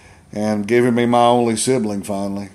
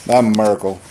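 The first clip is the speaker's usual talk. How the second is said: ordinary talk, nothing marked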